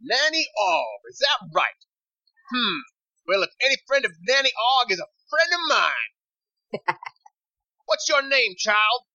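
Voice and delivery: high-pitched woman on a call; intense, high female character voice. Acted. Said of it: "intense female voice".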